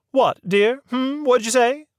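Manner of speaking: put-on a proper old lady leading the inquiry; prim lady voice. A narrator's reading. Mary Mabel asks Birdie.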